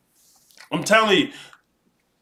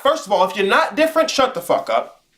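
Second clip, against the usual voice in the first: usual speaking voice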